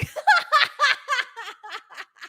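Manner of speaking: high rolling laugh at own mistake; helpless laugh. Real, not acted. Host laughing after misreading the chart. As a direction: laugh at yourself. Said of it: "this cracks me up".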